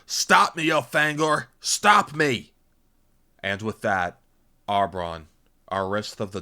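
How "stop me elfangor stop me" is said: high, loud, anguished shout; desperate plea. A narrator's reading. Arbron losing control begs Elfangor, desperate shout. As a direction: beg at the top of your voice.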